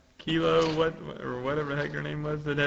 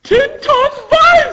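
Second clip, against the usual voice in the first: very high, booming slow shout; hammed-up high attack yell; battle cry; 18 dB louder, slower than usual. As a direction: bellow an attack name, drawn out. Acted attack cry from manga.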